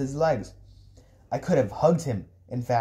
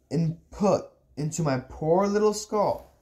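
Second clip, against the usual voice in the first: usual speaking voice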